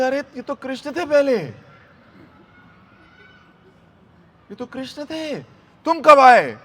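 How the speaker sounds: high-pitched flustered astonishment; हक्की-बक्की Gopi. Retold or imitated. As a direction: act thunderstruck, bursting into a loud final question. Krishna की जगह पति निकला. (हैरानी में ऊँची आवाज़)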